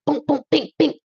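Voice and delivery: smack out each hit like a cartoon fight; mock punch sounds. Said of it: "But then God's like".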